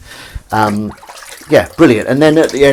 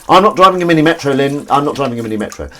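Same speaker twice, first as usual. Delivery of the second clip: indignant protest, louder and higher at the start; Partridge; 5 semitones higher, 5 dB louder, faster than usual. Retold or imitated. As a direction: quote an exasperated sitcom character, quick and insistent. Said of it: "favourite Partridge quote".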